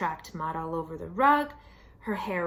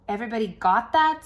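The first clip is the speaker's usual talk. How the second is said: put-on fast, louder bossy kid; bossy little brother; 7 dB louder, faster than usual. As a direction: demand it like a know-it-all kid. Ian checking rules read.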